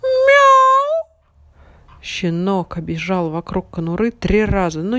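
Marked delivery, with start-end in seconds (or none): 0.0-1.1 high, loud meow. A narrator's reading. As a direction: belt out a meow. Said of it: "очень громко сказал".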